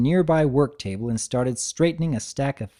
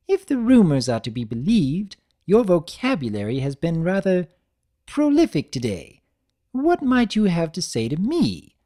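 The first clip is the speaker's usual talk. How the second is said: higher, refined lady voice, teasing; a higher, elegant mare's voice; Rarity voice; 6 semitones higher, 3 dB louder than usual. Acted. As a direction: play a prim fashion mare, raised pitch.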